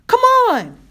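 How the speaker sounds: high, loud, desperate urging; frantic scream. A narrator's reading. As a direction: shriek for it to move. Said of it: "she screamed".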